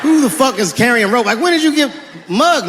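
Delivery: usual speaking voice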